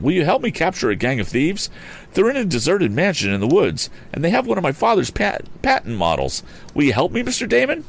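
urgent plea, pitched high; crying out. A narrator's reading. Young inventor begs help to capture thieves, crying out. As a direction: plead high and eagerly for help. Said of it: "cried Tom".

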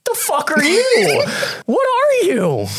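very high, startled cry; shocked squeal. Acted. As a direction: squeak out in disbelief. Character startled by wolf-man.

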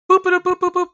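high-pitched, loud boops; imitated news alert beeps. Podcast host breaking in with wrestling release news, high beeping alert. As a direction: boop it out like a news ticker alert.

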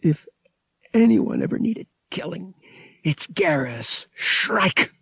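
high, halting, bitter verdict; bitter thought. A narrator's reading. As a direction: spit out the name bitterly, word by word. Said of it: "Han thought bitterly".

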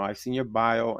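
usual speaking voice

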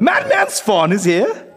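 rising, stretched disbelief; excited. Acted. Same question repeated.

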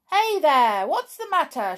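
loud, high storybook holler; indignant shout from a woken sleeper. A narrator's reading. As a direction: call out sharply to people up a tree. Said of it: "shouted the moon-faced person".

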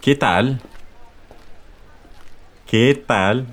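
"¿Qué tal?" with a rise; teacher models "¿Qué tal?" for repeating; model phrase. Shown as a demonstration. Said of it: "Translates to".

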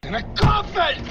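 high slow yell down the line; desperate shout. Acted. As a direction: shout so they hear you.

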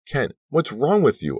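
high, loud, outraged demand; shrieked. A narrator's reading. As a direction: shriek it at him. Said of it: "I shrieked".

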